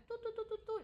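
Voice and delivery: high, even "tu tu tu" beeps; imitated keypad beeps. Explaining a car's keypad to co-host, high mimicked beeps.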